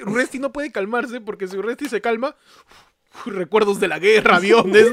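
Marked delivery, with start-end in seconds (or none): 3.3-4.9 grito burlón de veterano alterado; alarma bélica fingida. Pretend. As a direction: sube el volumen como reviviendo una guerra. Imagina los recuerdos bélicos de Urresti. (pánico de guerra exagerado, fuerte)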